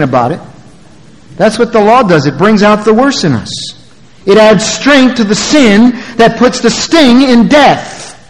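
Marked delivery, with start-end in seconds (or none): 1.4-8.2 high, emphatic sermon delivery; full-force preaching. Real, not acted. Preacher shows congregation what the law does, full-force preaching. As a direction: preach it high, slowing into the final phrase.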